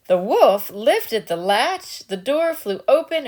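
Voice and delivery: ordinary delivery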